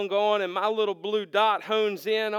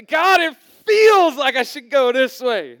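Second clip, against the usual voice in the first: much louder, slowed, hammed-up complaint; whining plea; 4 semitones higher, 10 dB louder, slower than usual. The preacher pleading with God, hammed-up whine. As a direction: whine it out like a stubborn child.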